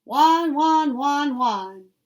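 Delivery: loud high puppy yips; yipping puppy. A narrator's reading. Professor showing his puppy how to bark, loud yips. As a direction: yip like a young puppy, loud. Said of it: "demonstrated for him, yipping like a young puppy".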